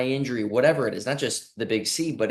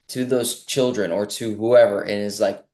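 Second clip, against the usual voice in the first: usual speaking voice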